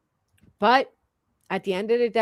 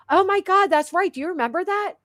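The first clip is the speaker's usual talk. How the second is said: it all comes back at once, thrilled; excited realization; 9 semitones higher than usual. Real, not acted. Sudden recollection.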